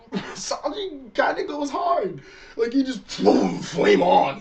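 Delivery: stretched-out, hyped fan excitement. Real, not acted. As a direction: sell the big moment like a fan losing it. Hyped retelling of Sanji's attack.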